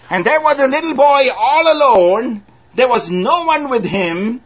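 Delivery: high, ringing preacher's voice; fervent pulpit delivery; preaching. Acted. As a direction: lift it up to the congregation. Preaching, little boy alone.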